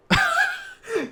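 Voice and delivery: a sudden high squealing laugh; a real squeal of laughter; high shrieking laugh. Real, not acted. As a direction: burst out in a shrill cackle. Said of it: "This shit was so funny to me".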